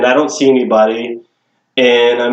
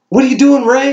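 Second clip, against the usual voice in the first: higher-pitched call to a trainee; mock calling-out from a puzzled leader; retold calling out; 12 semitones higher than usual. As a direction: call over to the rookie, pitched up.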